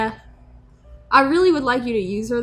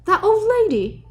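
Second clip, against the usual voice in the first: normal talking voice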